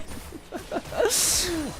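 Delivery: short high burst of laughter. Real, not acted. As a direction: laugh at the mess.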